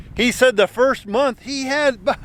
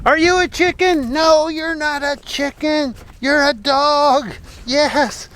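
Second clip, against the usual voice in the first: pet talk; 4 semitones higher, slower than usual. Real, not acted. Talking to the dog.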